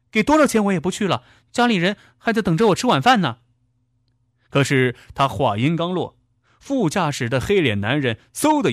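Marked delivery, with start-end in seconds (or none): 0.1-3.4 high, rapid protest; agitated refusal. A narrator's reading. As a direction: refuse fast in a raised pitch. Driver refuses to go any further.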